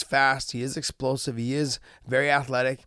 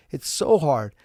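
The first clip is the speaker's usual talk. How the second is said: ordinary talk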